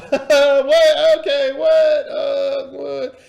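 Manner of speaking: loud, high nervous babble after a laugh; panicky, overwhelmed mock outcry. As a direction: act out being hugely flustered. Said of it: "Oh, don't be nervous".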